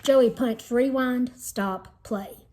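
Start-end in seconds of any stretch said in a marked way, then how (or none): none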